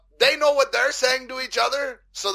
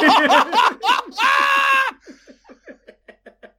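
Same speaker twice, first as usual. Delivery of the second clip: loud, high, drawn-out cackle; exaggerated laugh; 13 semitones higher, 5 dB louder than usual. Joker proves it's funnier now, loud hammed-up laugh. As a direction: laugh far too hard at your own joke. Laughing hard at own explained joke.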